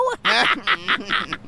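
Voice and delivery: high laugh after the punchline; mocking laugh. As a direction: crack up at a friend's disgrace. (cười chế nhạo, giọng cao)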